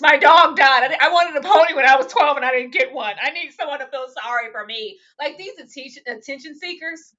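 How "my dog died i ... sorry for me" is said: loud, whiny parody of self-pity; exaggerated woe-is-me mimicry; mock excuse-making. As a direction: plead excuses in hammed-up complaint. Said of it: "All of those damn excuses".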